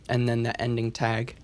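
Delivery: ordinary talk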